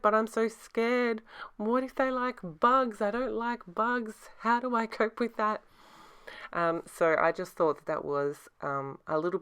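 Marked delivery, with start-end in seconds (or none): 0.0-5.6 high, slow, mock-frightened whine; mock scared. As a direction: play it as silly fear of bugs. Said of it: "And I was like".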